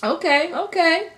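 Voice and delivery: pleasantly surprised. Real, not acted. Reacting to tasting new drink.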